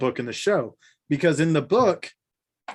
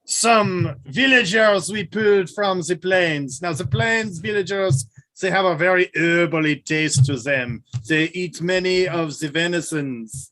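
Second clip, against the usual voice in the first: hammed-up TV chef in a put-on voice; Trolloc cooking show host; 4 dB louder than usual. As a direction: present the dish like a mock cookery host.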